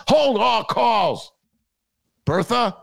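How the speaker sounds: high, slow pompous call; hammed-up order to the secretary; mock boss shout. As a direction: bark it like a big shot.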